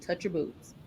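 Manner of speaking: usual speaking voice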